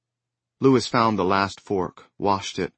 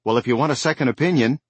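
fast, loud irritated snap; snapped; 5 semitones higher, 6 dB louder, faster than usual. Acted. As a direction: bite the words off fast. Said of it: "Lewis snapped".

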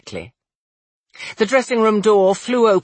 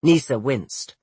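ordinary delivery, nothing marked

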